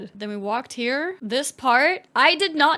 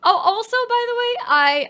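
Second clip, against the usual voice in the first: ordinary delivery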